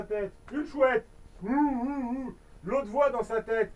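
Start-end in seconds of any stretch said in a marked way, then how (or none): 1.4-2.3 owl hooting. Acted. Owl call.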